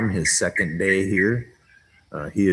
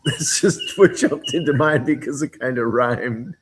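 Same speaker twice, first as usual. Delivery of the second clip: higher, giggling through the explanation; real laughing speech; 6 semitones higher, 4 dB louder than usual. Real, not acted. Vlogger explaining a silly rhyme, laughing. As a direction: talk while still cracking up.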